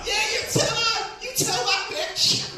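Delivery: put-on very high, loud drunken yell; drunk guy heckling. Drunk guy at the back cheering the comic on, rowdy shout. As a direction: holler from the back, dragging the last words. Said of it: "some drunk guy at the back like".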